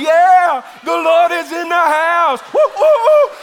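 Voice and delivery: very high, drawn-out whooping yell; hammed-up revival holler with whoops; mock ecstatic shout. Queen celebrating in procession, wildly shouting. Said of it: "she's up there going".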